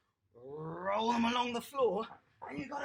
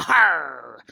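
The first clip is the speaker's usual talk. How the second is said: loud rasping pirate Arr; hammed-up pirate cry; pirate growl; 8 semitones lower, 17 dB louder than usual. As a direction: bark it out like a cartoon pirate. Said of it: "today we're gonna be a pirate".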